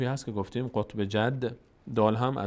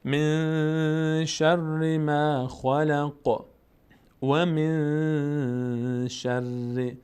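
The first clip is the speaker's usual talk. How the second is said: slow, drawn-out Quran recitation; tajwid recitation; 3 semitones higher, much slower than usual. Shown as a demonstration. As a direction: chant it slowly, stretching every vowel. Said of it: "Quran recitation". (تلاوت آرام و کشیده)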